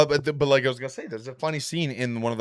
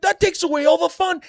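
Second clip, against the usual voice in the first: much higher, loud, cackling-edged Joker squeal; 9 dB louder than usual. Retold or imitated. Joker shrieking at Scarecrow over Batman's unmasking, high and loud. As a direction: shriek it like the Joker whose fun got ruined. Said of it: "He's like".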